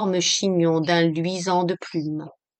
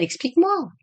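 much higher-pitched, puzzled plea; baffled insistence; 10 semitones higher than usual. A narrator's reading. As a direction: press for an explanation. Baffled friend demands an explanation. (insistance perplexe)

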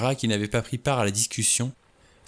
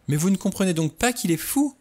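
much higher, urgent exclamation; cried out; 9 semitones higher, 3 dB louder than usual. A narrator's reading. Vera crying out that the killer is mad, much higher. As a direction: cry it out in alarm. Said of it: "s'écria Vera".

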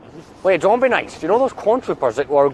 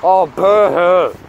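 mock crying; 2 semitones higher, 6 dB louder, much slower than usual. Mocking Lego's offence over a name.

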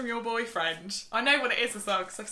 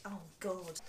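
usual speaking voice

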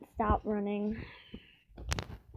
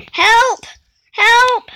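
high, loud panicked yell; panicked cry for help; 11 semitones higher, 14 dB louder than usual. Real, not acted. Zombie attack in game.

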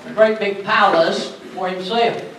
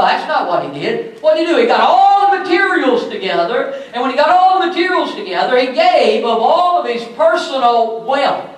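ordinary talk

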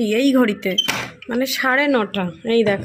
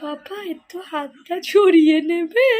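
slow, whispered tearful plea; mock weepy whisper, drawn out; imitating whiny daughter; 3 semitones higher than usual. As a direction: whisper it like a sick child begging papa. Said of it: "সকালবেলা বাবাকে বলছিলাম".

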